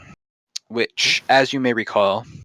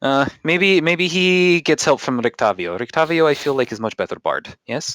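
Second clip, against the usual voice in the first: put-on higher-pitched Russian-accented barkeep; pondering in a put-on Russian accent; musing host with a Russian accent; 5 semitones higher than usual. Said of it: "the accent's just going away".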